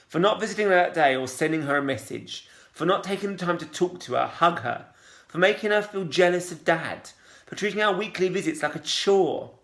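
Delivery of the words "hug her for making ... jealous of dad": usual speaking voice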